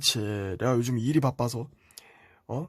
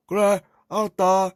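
higher, louder, slow parental acknowledgement; imitated parent; 4 semitones higher, 5 dB louder than usual. Parent accepts the child's excuse, slow and higher. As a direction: answer slowly as the parent, raised voice. Parent's reply in the acted exchange.

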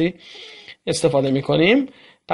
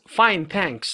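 slow, careful fine thanks; slow model answer. Shown as a demonstration. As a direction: say fine thanks slowly for learners. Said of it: "پس".

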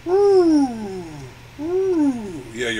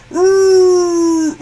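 much louder roared word; spoken roar; 4 semitones higher, 11 dB louder than usual. Acted. Elephant roars for chocolate.

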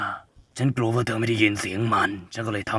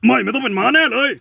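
high, loud, strange squeal of a voice; sudden shrill yell; 14 semitones higher, 7 dB louder than usual. Acted. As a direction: blurt it out in a strange shrieking voice. Creepy caller hiding the truth.

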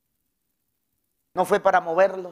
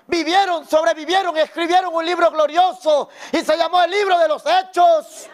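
preaching at full force to the congregation; full-voiced sermon, every phrase pushed out; fiery preaching; 9 semitones higher, 4 dB louder than usual. (predicando a toda voz ante la iglesia)

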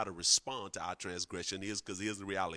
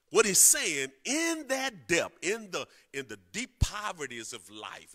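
higher and louder, stretching the words; fiery preaching; 7 semitones higher, 7 dB louder than usual. Acted. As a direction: preach it with rising fire. Preaching builds to full force.

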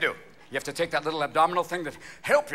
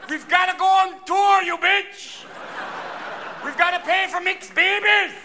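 put-on high, loud shrieking old man; hammed-up old man's yell; old Swiss man; 13 semitones higher, 3 dB louder, slower than usual. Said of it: "Some old Swiss man's going".

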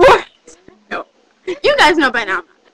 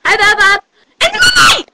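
excited shout; 7 semitones higher, 5 dB louder than usual. Real, not acted.

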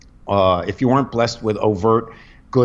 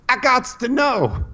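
slow and high, drawn out; high pleading quote; 12 semitones higher than usual. Retold or imitated.

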